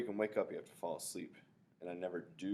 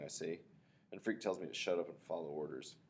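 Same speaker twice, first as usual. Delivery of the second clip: plain reading voice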